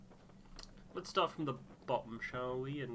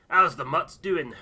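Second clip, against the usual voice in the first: much louder, higher, a gruff shout across camp; hearty call; 6 semitones higher, 18 dB louder than usual. Acted. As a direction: call it out loud and rough. Rough dog handler calls out.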